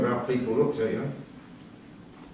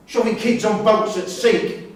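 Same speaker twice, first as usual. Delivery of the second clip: slow, raised and bitter, each word pushed out; angry accusation; 6 semitones higher, 4 dB louder, slower than usual. Acted. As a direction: spit out the accusation.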